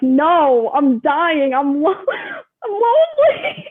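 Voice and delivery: hammed-up whine rising into a high fake sob; mock wailing. Quarantined athlete answering her coach, mock wailing. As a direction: wail it, playing up the misery. Retold exaggerated complaint to coach.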